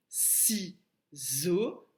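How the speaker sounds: ciseau said slowly, stretching the first syllable si; syllable-by-syllable demo. Shown as a demonstration. Teacher to preschoolers, sounding out ciseau slowly. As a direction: sound out ciseau for the class, si held apart. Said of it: "si est la première syllabe".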